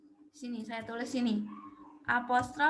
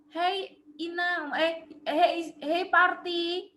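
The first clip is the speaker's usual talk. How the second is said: calling out; 4 semitones higher, 6 dB louder, much slower than usual. Acted. Calling a friend over to introduce.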